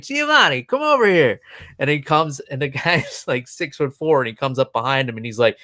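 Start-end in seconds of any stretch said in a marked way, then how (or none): 0.0-1.4 high-pitched summons; raised-pitch mock holler; calling someone over. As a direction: imitate calling a colleague across the room. Retold call to a bartender.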